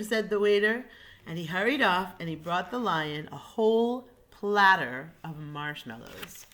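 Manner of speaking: usual speaking voice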